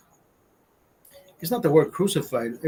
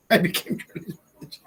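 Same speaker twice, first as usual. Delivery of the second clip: laughed-out words; amused, giggling as it comes out; laughing; 3 semitones higher than usual. Real, not acted. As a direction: say it through real laughter. Repeats it while laughing.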